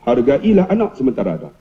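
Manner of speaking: low, earnest advice. Acted. Principal advises teacher to cherish children, low sincere tone. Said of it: "dia nada rendah".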